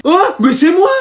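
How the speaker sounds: high, laughing outraged protest; indignant protest. Acted. Pupil to teacher, high laughing indignant protest. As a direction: squeal that it was you.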